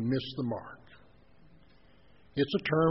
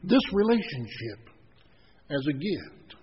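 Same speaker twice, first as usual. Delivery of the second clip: higher and louder, slowing into a tender offer; loving divine voice; 6 semitones higher, 4 dB louder than usual. Acted. Preacher voicing God offering love as a gift, tender and emphatic. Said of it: "God says".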